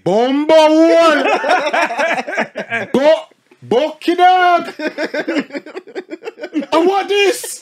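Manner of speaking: high, loud, slow exclamation; barber exclaiming. Retold or imitated. Imitating the barber shouting at his hairline, high and slow. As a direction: bellow it like a shocked barber. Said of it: "It said".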